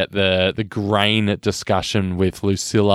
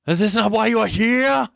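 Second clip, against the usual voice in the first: mock arena shout; 10 semitones higher than usual. Co-host continues the film outburst.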